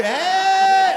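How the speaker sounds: loud, high, drawn-out "hey"; mocking call. Comic showing a stranger's fuck-you gesture, loud mocking call. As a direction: blare it out like an insult.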